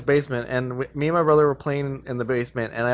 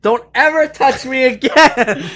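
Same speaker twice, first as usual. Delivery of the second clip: high, slow mock shriek; mock outrage; 10 semitones higher, 5 dB louder, slower than usual. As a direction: shriek it in fake offense. Playful mock outrage at friend.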